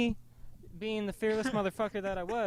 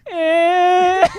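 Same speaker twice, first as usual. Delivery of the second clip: high, loud mock cry from a kid; a little sister's startled bawl; imitated wail; 12 semitones higher, 17 dB louder than usual. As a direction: wail like a kid who got hit. Sister crying out from next room.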